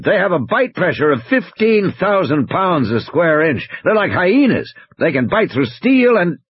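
high, urgent warning; animated game warden's report; Muldoon on the radio. Acted. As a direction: press the danger home over the radio.

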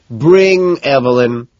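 loud, slow call; loud drawn-out shout; cry out. A narrator's reading. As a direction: call it out across the room. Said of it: "Van Patten cries out".

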